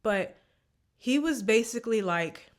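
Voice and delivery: ordinary talk, nothing marked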